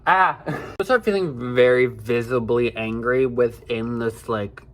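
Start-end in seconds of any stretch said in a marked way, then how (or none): 0.0-0.4 loud, exasperated "ah"; frustrated groan. Real, not acted. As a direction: let the frustration burst out. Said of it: "I'm getting frustrated".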